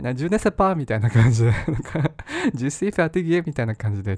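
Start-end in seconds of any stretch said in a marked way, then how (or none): 0.1-0.8 put-on playful French-accented ジュネセパー, a bit faster; ジュネセパー said with a light mock French lilt; mock French phrase. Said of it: "ちょっとフランス人な感じでやってると". 2.5-3.4 put-on ジュシーファティギエ in a light French accent, higher and quick; a weary little French phrase, half-joking; playful French accent on ジュシーファティギエ; mock French sigh. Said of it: "ちょっとフランス人な感じでやってると".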